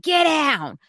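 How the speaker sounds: sharp high shout; yelling at the cat. Retold or imitated. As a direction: bark it at a cat on the chair.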